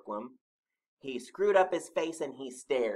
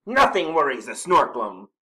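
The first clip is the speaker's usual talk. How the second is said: loud, higher dismissal; snorted boast; 4 semitones higher, 13 dB louder than usual. A narrator's reading. Snorklum denying worry to Mole, loud snorted boast. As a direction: brush it off with big bluster. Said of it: "snorted the Snorklum".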